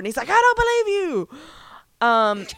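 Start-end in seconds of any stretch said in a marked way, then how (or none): 0.3-1.3 lightly hammed Abraham, much higher; mock villain. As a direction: camp up the disbelief.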